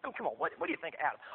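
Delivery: ordinary talk, nothing marked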